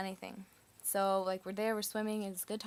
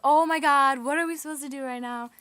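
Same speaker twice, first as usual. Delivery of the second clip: loud, raised-pitch panicked outburst; freaked-out exclamation; 5 semitones higher, 12 dB louder than usual. Retold or imitated. Friends react to the cop's call, freaking out loudly. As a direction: blurt it out in a loud reenacted panic. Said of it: "we're, like, freaking out".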